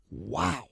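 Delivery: sharp gasp then a high wow; stunned gasp and wow; shocked gasp. Retold or imitated. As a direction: gasp like it knocked the wind out of you. Reacting to her being upset.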